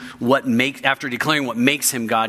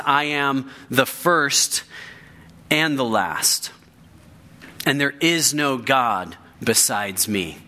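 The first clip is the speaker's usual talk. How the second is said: slow solemn declaration; slower than usual. Pretend. Quoting God's declaration slowly.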